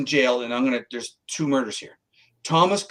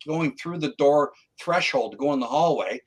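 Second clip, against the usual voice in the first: usual speaking voice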